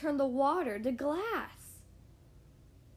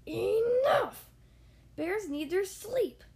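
loud, slow booming bear; 6 semitones higher, 6 dB louder, slower than usual. Acted. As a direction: boom it out, fed up. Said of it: "he boomed".

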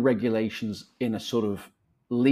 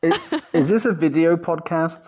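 laughing; 5 semitones higher, 4 dB louder than usual. Real, not acted. Embarrassed laughing reaction.

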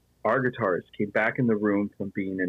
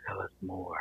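soft, intrigued request; hushed fascination; 3 semitones lower, 14 dB quieter than usual. Retold or imitated. Bandmates wanting more of the ghost story, hushed fascination. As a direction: drop right down, as if drawn in.

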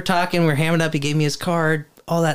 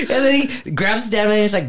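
normal talking voice

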